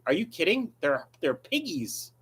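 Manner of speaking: ordinary delivery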